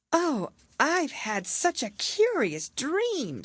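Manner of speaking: put-on high, slow, wondering girl's voice; dreamy Alice. Alice telling her sister the dream, dreamy and wondering. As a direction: marvel at it like a sleepy child. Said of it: "said Alice".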